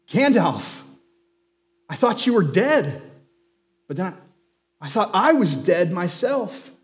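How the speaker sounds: a hint of breathless wonder; mild disbelief rising high; gasped. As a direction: Sam stunned to see Gandalf alive. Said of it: "At last he gasped".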